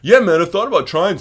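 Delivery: high, loud mock heckler voice. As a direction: imitate a cocky heckler piping up. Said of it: "so heckler".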